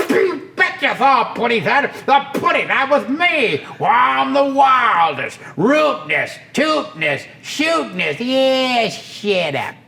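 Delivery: high blustering tomcat; cartoon cat voice.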